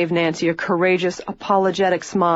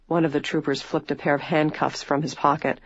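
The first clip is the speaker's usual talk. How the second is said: ordinary delivery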